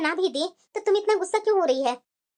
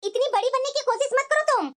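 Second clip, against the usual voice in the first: scolding; 8 semitones higher than usual. Acted. Mother scolds meddling daughter.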